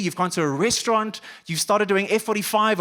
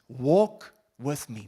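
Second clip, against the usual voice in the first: tender invitation; 5 semitones lower, much slower than usual. Retold or imitated. God's invitation voiced by the preacher.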